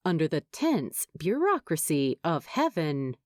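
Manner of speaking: ordinary delivery, nothing marked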